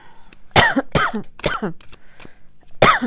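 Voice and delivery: harsh coughing fit; choking cough. Real, not acted. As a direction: cough hard after swallowing wrong. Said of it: "J'ai avalé de travers".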